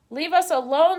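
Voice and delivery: high, loud frightened squeak; weasel squeak. A narrator's reading. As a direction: squeak it out in fear. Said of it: "squeaked Dart".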